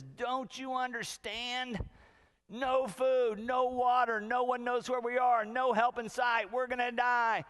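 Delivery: high-pitched, hammed-up doom-crying; frantic panic. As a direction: pound out each disaster in a loud panic. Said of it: "one guy is just frantic".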